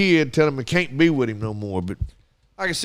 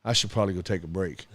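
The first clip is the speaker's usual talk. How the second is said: put-on calm kid; 7 semitones lower, 8 dB quieter, faster than usual. Hurt boy calmly deciding to sit out, low and soft. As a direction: lower and softer, matter-of-fact. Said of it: "He was like".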